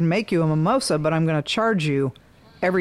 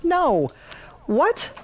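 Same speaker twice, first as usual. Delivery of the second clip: high-pitched, incredulous disbelief; baffled, can't believe the nerve; 8 semitones higher than usual. Real, not acted. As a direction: scoff at it in higher-pitched disbelief. Scoffing at the customer's entitlement.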